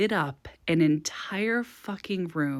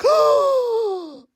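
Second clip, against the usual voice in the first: gasp, then a high loud Oh!; shocked gasp; 6 dB louder than usual. Retold or imitated. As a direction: gasp in outrage at the kiss. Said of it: "I think I was like".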